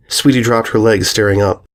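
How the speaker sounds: usual speaking voice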